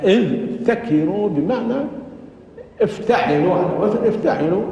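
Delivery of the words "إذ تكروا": إذ تكروا as idh-takiru; unmerged dh before a clear t in إذ تكروا; still dhal, then ta. Shown as a demonstration. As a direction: keep dh and t separate in إذ تكروا. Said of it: "ساكنة والتاء".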